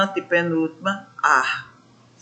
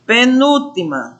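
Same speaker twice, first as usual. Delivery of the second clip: usual speaking voice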